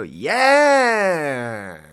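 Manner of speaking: loud, drawn-out caricature yell; mocking self-impression. Showing how people impersonate the speaker, loud drawn-out mimicry. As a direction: stretch one word into a loud caricature. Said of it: "People do impressions of me and they go like this".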